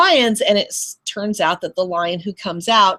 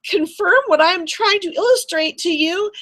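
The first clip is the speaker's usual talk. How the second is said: flustered protest; 7 semitones higher than usual. Retold or imitated. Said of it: "then I'm like".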